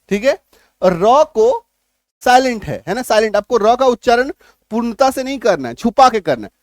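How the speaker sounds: ordinary talk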